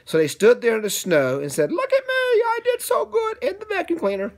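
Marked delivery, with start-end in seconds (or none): 1.7-4.3 high, boastful little voice; proud squeaky voice. Acted. A sneetch boasting to the others, high and proud. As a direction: show off like a proud kid. Character boasts after the ride.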